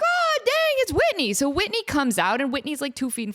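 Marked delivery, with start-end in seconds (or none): 0.0-1.3 very high, excited exclamation; starstruck surprise. Retold or imitated. Audience member spotting [name] from the front row, thrilled surprise. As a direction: squeal it in amazed recognition.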